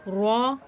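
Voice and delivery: "را" with a thick, full R; heavy R. Shown as a demonstration. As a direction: say "را" full-mouthed, R kept heavy. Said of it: "را بھی پر". ("را" موٹی، پُر آواز میں)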